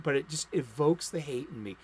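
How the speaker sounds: ordinary talk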